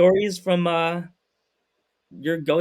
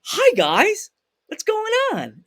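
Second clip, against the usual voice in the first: much higher, bright video-host hello; Paul Feig greeting; slower than usual. Retold or imitated. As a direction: greet like a host welcoming viewers. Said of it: "His typical reaction he does in every video".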